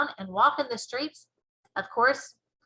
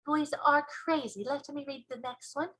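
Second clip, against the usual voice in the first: put-on young girl; 5 semitones higher, 4 dB quieter than usual. Girl wants the next dream, high and softer. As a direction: lightly exclaim as a young girl. Girl's reaction in story.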